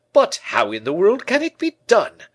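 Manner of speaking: high, loud, eager question; delighted. A narrator's reading. Porthos asks Moliere about the invention, loudly delighted. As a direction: marvel in a big, delighted character voice.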